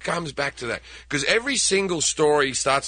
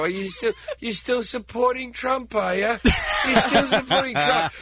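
taunting; 8 semitones higher than usual. Retold or imitated. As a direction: poke him with a teasing lilt. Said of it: "I go, I go".

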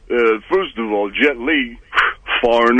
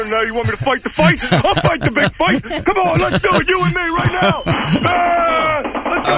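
hammed-up battle cry. Mock fight challenge.

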